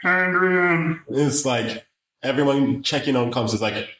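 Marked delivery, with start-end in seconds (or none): 0.0-1.0 high, drawn-out goofy creature voice; King Shark. Retold or imitated. Retelling the shark's scene, in a high drawn-out dopey voice. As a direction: stretch the word out like a simpleton. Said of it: "Sylvester Stallone plays King Shark".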